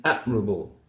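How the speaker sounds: "admirable" with the stress on ad; admirable, first syllable stressed; correct stress. Shown as a demonstration. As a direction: hit AD, then shorten the rest of admirable. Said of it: "This one is the correct one".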